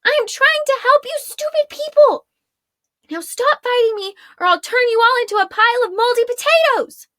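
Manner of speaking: high, loud angry yelling; furious shout. Acted. Arella yelling at the unruly prisoners, furious and high. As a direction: blast them with fury, a bit fast at the end. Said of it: "Fuming with anger".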